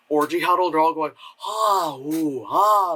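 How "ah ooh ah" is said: raised, drawn-out mock moans; mock moaning and groaning. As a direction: imitate a huddle moaning theatrically. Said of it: "like, moaning and groaning".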